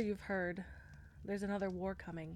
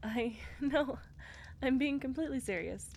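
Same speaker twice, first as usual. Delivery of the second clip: laughing; 5 semitones higher than usual. Acted. Laughs, insists she's serious.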